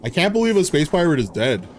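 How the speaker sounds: normal talking voice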